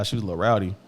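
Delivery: ordinary delivery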